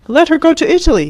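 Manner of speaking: high, loud exasperated cry; cried out. A narrator's reading. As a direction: fling it at his mother, pitch up. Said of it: "he cried".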